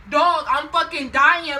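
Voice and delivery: loud, high, dragged-out mock wail; retold panicked outburst. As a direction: blurt it out in exaggerated panic. Acts out her panic in bathroom.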